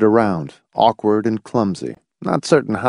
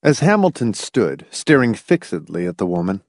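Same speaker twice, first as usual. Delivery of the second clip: ordinary delivery, nothing marked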